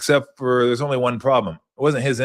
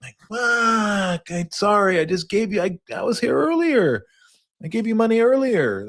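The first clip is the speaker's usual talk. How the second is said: acted panicked self; 12 semitones higher than usual. Acted quote of himself at store.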